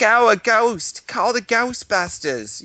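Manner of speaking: high, slow mock-alarmed secretary; Ghostbusters secretary. Imitating the secretary in the Ghostbusters commercial, mock alarm. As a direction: cry it out like a hammed-up ad secretary. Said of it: "their secretary's like".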